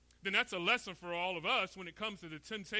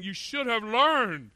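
higher-pitched, lightly voiced divine reproach; impassioned questioning, slowing on the reproaches; God rebuking; 5 semitones higher, 4 dB louder than usual. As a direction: preach it as a pointed rebuke from God. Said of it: "Because what God is saying".

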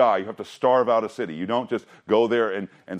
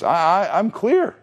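relieved David; 9 semitones higher, 4 dB louder, slower than usual. Retold or imitated. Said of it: "He thinks".

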